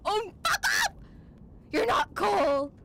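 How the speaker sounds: loud, high scolding yell; outraged shout. Real, not acted. Viewer scolding climbers at the top, outraged shout. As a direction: yell it at them, appalled.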